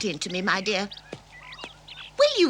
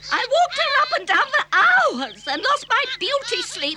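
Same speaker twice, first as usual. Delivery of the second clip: high, drawn-out grievance; slow, high-pitched self-pity; indignant complaint; 9 semitones higher than usual. Acted. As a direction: wail about the hardship, slowly. Indignant complaint about sleepless night.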